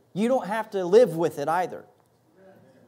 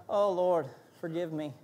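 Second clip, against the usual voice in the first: penitent prayer; 5 semitones lower, 6 dB quieter than usual. Retold or imitated. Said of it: "And I said".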